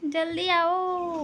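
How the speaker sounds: જલ્દી આવો stretched very slow, calling; drawn-out call. Shown as a demonstration. Mother demonstrating the call to her toddler, slow drawn-out. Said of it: "બૂમ પાડ પાછી".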